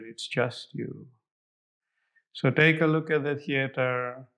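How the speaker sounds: guided meditation. Acted. Guided visualization meditation continues.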